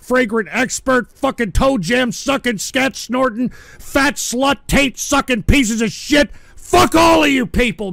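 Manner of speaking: real angry yelling; angry shout. Real, not acted. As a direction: spit the insults out in rage. Ranting at chat.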